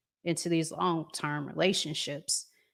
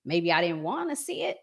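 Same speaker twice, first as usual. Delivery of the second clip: ordinary talk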